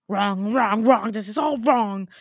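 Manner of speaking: loud, put-on hat voice; agitated sorting hat. Sorting hat rejecting its own result, acted agitated outburst.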